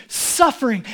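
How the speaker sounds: ordinary talk, nothing marked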